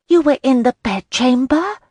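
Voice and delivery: high, loud, scandalised gasp; shocked and thrilled all at once. Acted. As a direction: friend gasping at the bedroom news. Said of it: "Maddie gasped".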